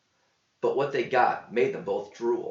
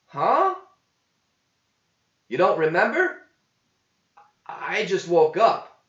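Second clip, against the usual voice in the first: baffled companion; confused huh; 8 semitones higher, 7 dB louder than usual. A narrator's reading. Gear confused.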